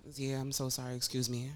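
low, soft retold apology; quietly contrite, voice dropped; sheepish apology. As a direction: imitate a chastened parent, hushed. Parent's apology to child, retold.

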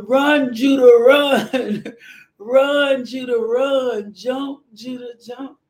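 loud trackside cheer, half laughing; mother cheering her son on from the stands; retold cheering. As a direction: recreate yelling for her son at the meet. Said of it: "I was going".

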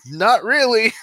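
high, loud imitated player voice; high mock-distressed reply; retold reluctant reply. Wounded player answers shooter.

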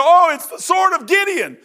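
high, loud cry of alarm; excited shout. Acted. Enemy soldiers crying out on spotting the sword, loud alarmed shout. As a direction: bellow it out as the startled enemy.

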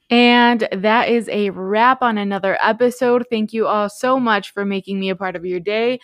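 loud, energetic sign-off to listeners; upbeat host wrapping up the episode; podcast outro read. Real, not acted. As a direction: project a scripted show outro with punch.

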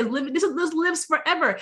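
ordinary talk

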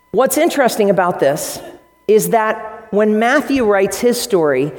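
ordinary delivery

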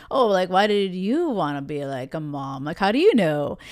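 put-on low voice of an evasive guy; player voice. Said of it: "I have this voice for these players".

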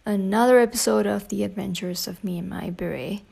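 ordinary delivery

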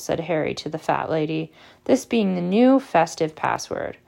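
ordinary delivery

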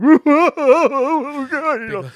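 high, mock sobbing wail; ugly crying. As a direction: imitate someone bawling their eyes out. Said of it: "like, ugly crying".

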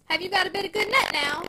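condescending lady of the house; patronizing. Acted. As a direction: talk down to the packman. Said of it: "in a patronizing tone".

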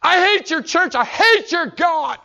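put-on a drunk father's hateful roar; drunk father's rant. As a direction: spit out the hatred slowly. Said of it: "He said".

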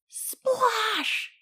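high, drawn-out "splash"; exclaimed storybook sound effect; big splash. A narrator's reading. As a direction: burst out with the splash. Thieves fall into the water.

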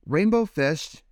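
little fish calling. Acted. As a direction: call after him. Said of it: "he called".

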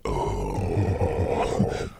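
low, flat Lurch groan. Retold or imitated. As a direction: drop into a lightly voiced Lurch. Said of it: "He's Lurch from the Addams Family".

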